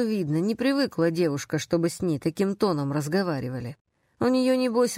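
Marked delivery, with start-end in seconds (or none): none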